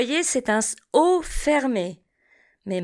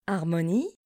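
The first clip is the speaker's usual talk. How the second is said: Harmonie repeated softer and lower; 5 semitones lower, 6 dB quieter, faster than usual. Shown as a demonstration. Teacher echoes Harmonie quietly for the class. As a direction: softly echo Harmonie. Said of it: "Répétez après moi".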